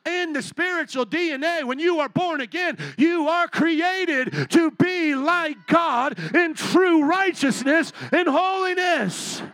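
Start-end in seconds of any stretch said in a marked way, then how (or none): 3.0-9.4 slow, loud, crying-out preacher; preacher's cry, louder and slower; full preaching. Acted. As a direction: stretch each word out like a revival peak.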